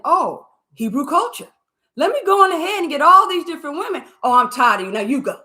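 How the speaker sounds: high, loud mocking voice of a player; mock womanizer. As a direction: sneer at him as a careless player.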